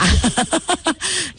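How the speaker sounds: high rising laugh at the joke; real laugh at the bottled water quip; bright laugh. Real, not acted. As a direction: burst out laughing, amused.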